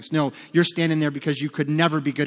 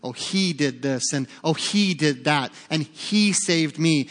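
"he" leaned on each time, slow; stress on he; slower than usual. Shown as a demonstration. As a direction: hit every he. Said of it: "start in the third person".

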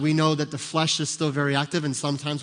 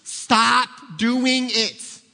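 high, loud, very slow stern command; Jesus telling you to quit, loud and slow; Jesus scolding; 7 semitones higher, 5 dB louder, much slower than usual. Pretend. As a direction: spell out each word like a stern order. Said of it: "That's Jesus".

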